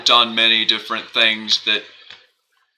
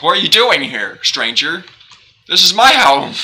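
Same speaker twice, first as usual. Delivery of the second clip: high, loud, huffy homeowner; indignant villager; 7 semitones higher, 5 dB louder than usual. Pretend. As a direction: demand it like an offended owner.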